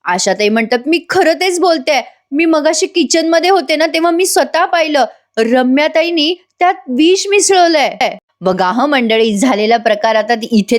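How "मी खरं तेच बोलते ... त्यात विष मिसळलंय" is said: retold higher-pitched earnest accusation; mildly acted witness insisting she saw it; insistent accusation. Asha insists on her accusation.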